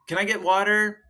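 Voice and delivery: put-on child politely asking a parent for water. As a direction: ask like a little kid. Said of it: "meaning if they say".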